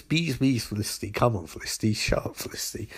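soft, trailing-off mutter; fading exasperation. Acted. Barry giving up arguing with Felicity, quietly exasperated.

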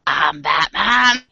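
put-on slow Boston accent Batman growl. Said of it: "add the Boston accent".

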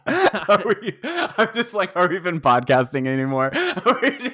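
high, loud, laughing through words; can't stop laughing. Real, not acted. Laughing at going off-topic.

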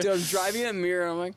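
ordinary delivery

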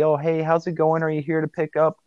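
high-pitched, very happy greeting; cheerful greeting. Retold or imitated. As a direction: greet a pickup customer brightly and cheerfully. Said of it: "very cheerful, very happy".

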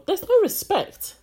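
high, loud, slow indignant protest; indignant outburst. Retold or imitated. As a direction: draw it out in high-pitched outrage. Acting out her outraged reaction.

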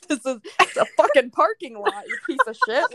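mock outrage over a parking spot; mock angry rant. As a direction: tell him off, hammed up for laughs. Ranting at boy over parking.

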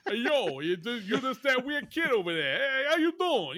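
loud, exaggerated street tough; Grease greaser. Gang leader hassling a weird kid, mock greaser. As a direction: put on a hammy greaser swagger. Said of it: "escaped out of a touring company of Grease".

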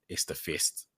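usual speaking voice

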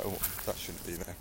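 normal talking voice

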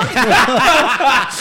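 loud burst of laughter; big laugh. Real, not acted. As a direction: crack up at the punchline. Risada do narrador. (risada alta)

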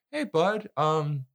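high-pitched, gently frank pal impression; friendly. Dead friend warning his buddy about hurting people, friendly. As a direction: imitate a buddy breaking bad news nicely. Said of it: "speaking to him like a friend".

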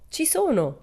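high, bright eureka shriek. Acted. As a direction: burst out with the answer. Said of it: "strillò".

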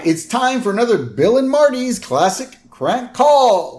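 high, punchy mock radio-host intro; shock jock announcer. Radio host introducing a crank call bit, hammed-up shock jock. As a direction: sell it like a morning zoo host. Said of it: "shock jock shows".

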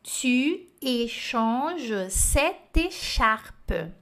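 Tu échanges cette écharpe read very slowly; slow dictation voice. Shown as a demonstration. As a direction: dictate each French word clearly. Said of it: "Frase número dois".

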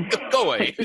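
high, loud, laughing shoo; mock dismissal through laughter; laughing brush-off. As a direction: wave them off while laughing.

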